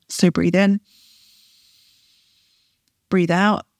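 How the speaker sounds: calm paced breathing prompts; breathing cue. Shown as a demonstration. Coach guiding longer out breaths, calm. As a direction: ease them through each breath. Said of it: "make that out breath a little bit longer".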